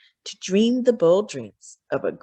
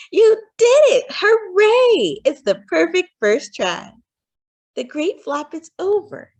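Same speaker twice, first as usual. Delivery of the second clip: much higher, louder delighted cry; aunt cheering her niece's first try; overjoyed cheer; 10 semitones higher, 7 dB louder than usual. Acted. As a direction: whoop it out like a proud aunt. Aunt cheers Rosie's flop.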